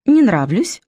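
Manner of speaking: «Не» поднято, настойчиво; insistent repeat. A narrator's reading. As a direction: press the question harder. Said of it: "настойчиво повторила". (настойчиво, с нажимом на «Не»)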